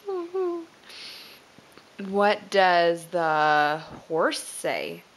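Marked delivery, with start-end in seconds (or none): 0.1-0.6 high, soft owl hoots; a quieter hoot, still high like an owl; gentle owl call for a toddler. Pretend. Parent voices owl again, softer.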